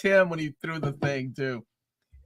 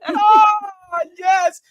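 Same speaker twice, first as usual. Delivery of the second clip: overjoyed cry; 8 dB louder than usual. Real, not acted. Fan reacting to Blade.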